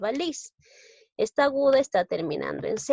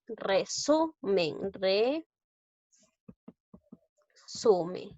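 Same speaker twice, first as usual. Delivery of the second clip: "Resumen" very slow, re-su-men; teacher syllabifying "resumen" with long gaps; syllable by syllable; slower than usual. Shown as a demonstration. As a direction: break "Resumen" into separate drawn-out syllables. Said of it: "Vamos a silabear".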